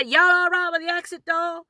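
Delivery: high, loud American stewardess voice. Retold or imitated. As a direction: put on an American flight attendant's twang. Imitating American flight attendant on plane.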